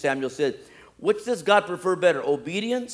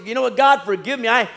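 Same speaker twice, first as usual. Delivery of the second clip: loud, high-pitched impassioned plea; crying out for mercy; 8 semitones higher, 9 dB louder than usual. Acted. Preacher imagines Saul confessing and begging forgiveness, loud crying out. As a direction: cry out your confession to God. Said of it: "would have cried out in the mercy".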